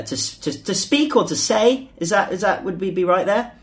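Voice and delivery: ordinary talk, nothing marked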